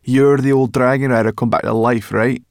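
high, loud jeering; mock them with exaggerated agreement; brash, needling sarcasm; sarcastic. A narrator's reading. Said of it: "said Sorrel sarcastically".

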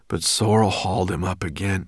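ordinary delivery, nothing marked

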